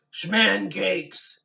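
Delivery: a lightly voiced monster, loud; a hint of a hungry monster; hungry thing voice. A narrator's reading. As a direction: announce the food like a creature. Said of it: "answered the hungry thing".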